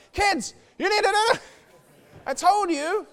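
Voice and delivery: high, loud mock scolding; mock temper with kids. Father losing his temper at the kids, high hammed-up scolding. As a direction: play the cross dad, high and loud. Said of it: "maybe I lose my temper".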